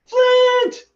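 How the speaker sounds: high, very loud shout of a friend's name; joyful cry. Acted. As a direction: yell his name with delight. Tasselhoff cries out joyfully.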